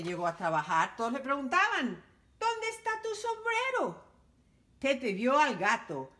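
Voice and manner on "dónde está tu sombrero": surprised question. Acted. Everyone asks Pepe.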